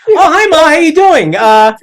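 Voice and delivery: very high, loud mock cheer; fake cheery greeting. Expelled son phoning his mother, mock cheery squeal. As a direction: squeal a falsely casual hello.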